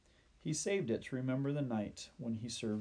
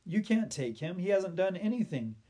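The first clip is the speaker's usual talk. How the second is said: high, raised, pleading protest; desperate protest; 7 semitones higher, 5 dB louder than usual. A narrator's reading. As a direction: cry out in a boy's defence. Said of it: "he cried".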